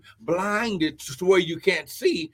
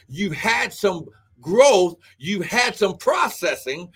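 raised, driving preacher's delivery; preaching; 4 semitones higher, 4 dB louder than usual. Real, not acted. Preacher telling the congregation God is moving them, preaching. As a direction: preach it with mounting force.

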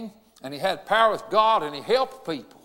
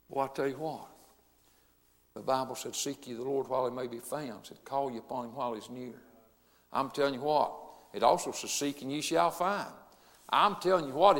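much softer and lower, gentle invitation; soft altar call; 8 semitones lower, 5 dB quieter than usual. Acted. Funeral preacher gently inviting mourners to salvation, soft and low. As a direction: draw the mourners in quietly.

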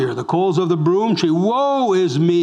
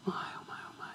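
whisper; 22 dB quieter, faster than usual. Real, not acted. Preacher sighs in awe.

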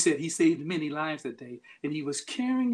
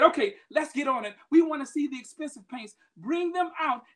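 higher, loud demanding crowd; angry crowd; 9 semitones higher, 4 dB louder than usual. Retold or imitated. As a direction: push for the masterpieces, annoyed. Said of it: "the crown was becoming angry".

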